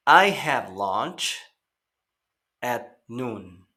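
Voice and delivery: slow, careful "I have lunch at noon"; slow teacher model; slow model sentence. Shown as a demonstration. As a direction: read it slowly to be repeated. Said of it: "Recuerden escuchar y repetir".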